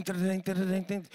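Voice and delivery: playful vocal guitar imitation; imitated guitar strum. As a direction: mimic the guitars with your voice.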